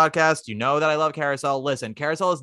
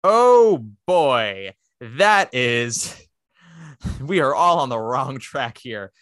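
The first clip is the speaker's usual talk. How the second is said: put-on dismayed oh boy; 4 dB louder, much slower than usual. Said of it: "that I went".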